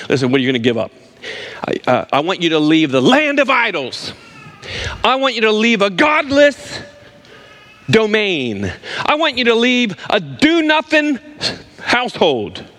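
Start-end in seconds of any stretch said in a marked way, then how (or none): 1.7-12.6 God's voice. Acted. Voicing God's call to Abram.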